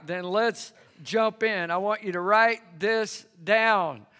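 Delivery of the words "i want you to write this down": ordinary talk, nothing marked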